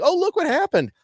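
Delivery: high mocking falsetto; mock Karen voice. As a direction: sneer it in a high prissy voice.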